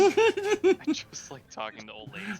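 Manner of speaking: put-on high, slow, laughing old lady. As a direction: creak it out like a cheeky granny. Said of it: "she likes when I do my old lady voice".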